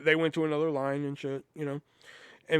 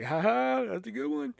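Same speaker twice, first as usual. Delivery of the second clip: fake polite laugh; 8 semitones higher than usual. Humoring customer he thinks jokes.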